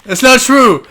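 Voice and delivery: loud, high-pitched denial; mock protest. Friend jokingly defends dad over the cats, loud mock protest. Laughing protest at own joke.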